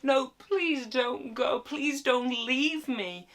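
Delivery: high, slow, whiny begging; hammed-up weepy imploring; mock tearful pleading. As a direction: plead in a drawn-out fake cry. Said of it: "she's crying and chasing after him".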